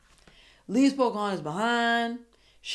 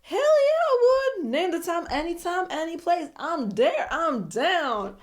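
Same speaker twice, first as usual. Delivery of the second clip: much higher, louder mock-eager agreement; eager yes; 10 semitones higher, 5 dB louder than usual. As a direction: jump at the invitation. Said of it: "He said".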